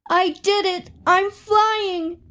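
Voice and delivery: high, thrilled cry of triumph; excited shout. A narrator's reading. Little chick cheering at his first flight, high and thrilled. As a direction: chick bursting with joy, squeaking it out. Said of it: "He wanted to shout".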